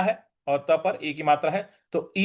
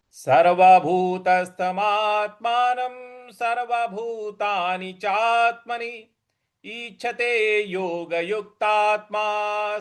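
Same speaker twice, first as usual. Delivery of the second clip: verse recitation; 4 semitones higher, much slower than usual. Acted.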